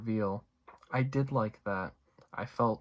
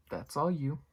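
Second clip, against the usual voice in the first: higher, pointed "that's all you" with weight on "all"; emphatic; 5 semitones higher than usual. Real, not acted. Vlogger telling Cecilia it's her problem, emphatic. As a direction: push it back on her firmly. Comment on calling nine one one.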